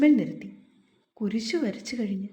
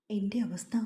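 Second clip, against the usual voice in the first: pleading whisper; 3 semitones lower, 7 dB quieter than usual. Acted. Girl pleading with Aloshi.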